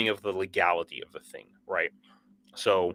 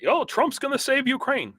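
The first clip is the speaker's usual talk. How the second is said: high, sing-song mock of a naive claim; mocking quote. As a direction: toss it off in a high, scoffing parody. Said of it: "Boris Johnson also has said that".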